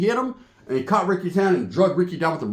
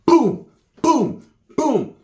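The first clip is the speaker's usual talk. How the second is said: high, punchy booms; punch sound effects; 7 semitones higher, 3 dB louder, much slower than usual. Retold or imitated. Storyteller shows Sheila rocking a dude, punchy imitated blows. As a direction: imitate blows landing one by one.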